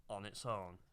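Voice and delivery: usual speaking voice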